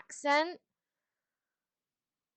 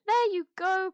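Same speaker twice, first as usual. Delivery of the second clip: excited pet praise; 10 semitones higher, 6 dB louder, slower than usual. Real, not acted. Praising the dog Comet.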